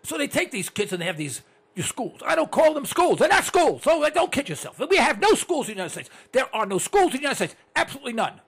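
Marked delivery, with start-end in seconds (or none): 2.3-8.4 raised, high-pitched insistence; real worked-up radio tirade; heated rant. Real, not acted. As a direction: hammer each denial emphatically. Heated rant about schools.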